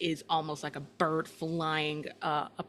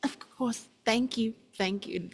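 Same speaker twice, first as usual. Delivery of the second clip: slightly pitched-up, hasty thanks; lightly voiced youth shooing her off with thanks; a touch of impatient politeness; politely dismissive young man; 5 semitones higher than usual. Young man hurrying crone away.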